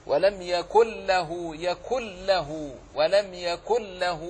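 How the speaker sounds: slow, يكن له with the نون gone and a doubled لام; showing idgham in يكن له; doubled lam demo. Shown as a demonstration. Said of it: "تنطق بلام مشددة فقط".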